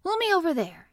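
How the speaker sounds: high, loud, lordly order; imperious command. A narrator's reading. Colin ordering Dickon to push him, imperious. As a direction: command it like a young rajah. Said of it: "he commanded".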